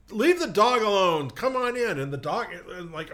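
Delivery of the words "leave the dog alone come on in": higher, louder exasperated parent calling; hint of a fed-up parent; mock irritated parent. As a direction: call out like an annoyed parent. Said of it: "they go like".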